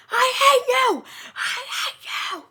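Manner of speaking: very high, strained screech fading into a whispery squeal; imitated tantrum, squeaky and drawn out; mock angry tantrum. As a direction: act out a hammed-up fit of fury. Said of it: "And then he gets angry".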